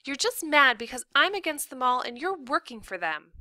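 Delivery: put-on high-pitched indignant kid; child's heated accusation; accusing daughter. A narrator's reading. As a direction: argue back in a young girl's voice.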